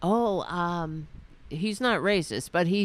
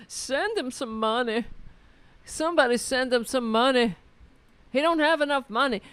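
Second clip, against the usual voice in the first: higher, fast hammed-up beggar; mocking donation pitch; mock fundraising plea; 5 semitones higher, faster than usual. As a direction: beg the crowd for cash in a mock pitch. Mock plea for the con man.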